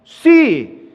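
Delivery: short, high, loud yes; defiant yes. Acted. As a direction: fire back yes. Wife's defiant answer.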